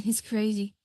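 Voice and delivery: ordinary talk, nothing marked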